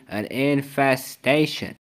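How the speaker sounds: "an infestation" spelled out slowly; slow emphatic repeat. Acted. Pest expert repeats the warning to the homeowner, slow and emphatic. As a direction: lean on every syllable of "infestation". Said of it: "I repeat".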